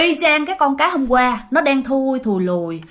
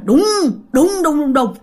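booming "đùng" thuds; imitated banging; 2 semitones higher than usual. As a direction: mimic heavy blows on a wall. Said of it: "Nghe là giống như ai mà đập vô tường".

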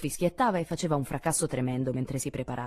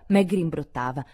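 ordinary delivery, nothing marked

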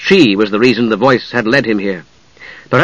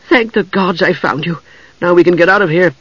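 relieved; 6 semitones higher than usual. A narrator's reading. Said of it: "relief in her voice".

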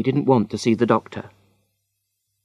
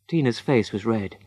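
usual speaking voice

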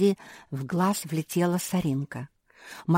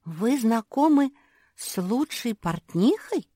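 higher-pitched astonished question; amazed; 6 semitones higher than usual. A narrator's reading. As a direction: ask it in wonder, voice lifted. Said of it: "изумилась Куки". (изумлённо, повышенным голосом)

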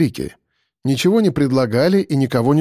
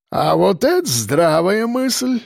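put-on slow, higher-pitched old man voice; drawn-out grandfatherly approval; creaky old grandad; 6 semitones higher, slower than usual. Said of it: "проскрипел, наконец, дед Ефим".

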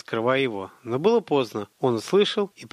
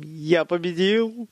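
drawn-out, higher wheeze of victory; triumphant croak; 5 semitones higher, much slower than usual. A narrator's reading. Winded boy claims victory over his sparring partner, croaking. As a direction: croak it out slowly, pitched up. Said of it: "прохрипел он".

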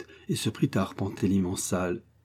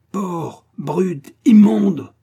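furious scream, rising much higher and louder; yelled insults; 9 semitones higher, 9 dB louder, much slower than usual. A narrator's reading. Prince screaming at the police chief, furious yell. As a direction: spit the insults out in a building rage. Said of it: "hurla-t-il".

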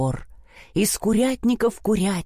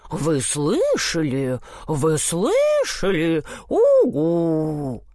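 low, slow hooting gossip; slow owl voice; 3 semitones lower, much slower than usual. A narrator's reading. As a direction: drag it out like an owl. Owls gossip.

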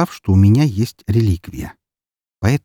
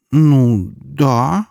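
hesitant, drawn-out, higher reply; reluctant, guilty little confession; sheepish admission; 5 semitones higher than usual. A narrator's reading. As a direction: admit it as if confessing to a crime. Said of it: "как-то странно призналась она, будто сознавалась в преступлении".

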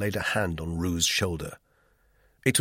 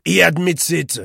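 high-pitched, mocking taunt; jeering; 9 semitones higher, 6 dB louder than usual. A narrator's reading. Roux mocks Duclos's admission, jeering. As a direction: jeer his excuse back at him. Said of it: "he jeered".

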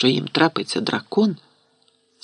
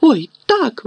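high cry of realization; sudden exclamation; 11 semitones higher, 4 dB louder than usual. A narrator's reading. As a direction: exclaim as it dawns on you. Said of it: "вигукнула Герміона".

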